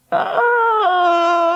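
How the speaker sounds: long, high-pitched scream; piercing scream. Acted. Someone screaming down the alleyway, piercing. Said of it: "a piercing scream echoed".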